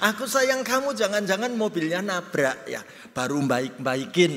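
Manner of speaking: high, laughing mock of a wary husband; high laughing tease; laughing suspicion. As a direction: chuckle through the husband's doubt.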